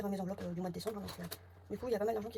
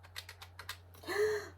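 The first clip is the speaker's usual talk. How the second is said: a sudden high gasp of shock; sharp gasp; 11 semitones higher than usual. Real, not acted. Woman checking her budget, gasping at a surprising balance. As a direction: gasp as if the number can't be right. Sees surprising account total.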